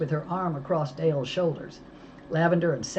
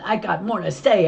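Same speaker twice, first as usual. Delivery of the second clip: louder, higher cry; shout; 5 semitones higher, 8 dB louder than usual. A narrator's reading. Mo shouting as she leaps for the phone. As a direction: fight for the phone. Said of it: "I shouted".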